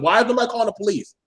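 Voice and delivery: ordinary talk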